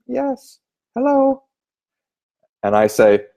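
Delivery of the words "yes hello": put-on much higher, thin old-lady voice; hint of a frail granny on the phone; little old lady. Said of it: "you get a little old lady".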